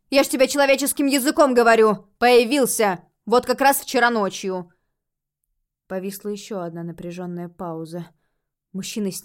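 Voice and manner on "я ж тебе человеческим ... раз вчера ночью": loud, exasperated insistence; snapping retort. A narrator's reading. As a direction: drive it home loudly, irritated. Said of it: "огрызнула женщина".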